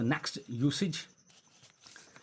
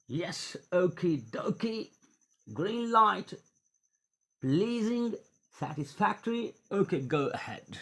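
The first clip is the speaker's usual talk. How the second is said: playful approval; 6 semitones higher, much slower than usual. Acted.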